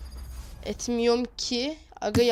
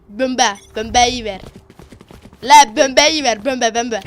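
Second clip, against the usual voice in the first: put-on joking Arabic accent, loud; 8 dB louder than usual. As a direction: fake Arabic accent, bombayı as bœmbæji. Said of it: "Arap taklidi yapayım mı?". (şakadan Arap aksanı)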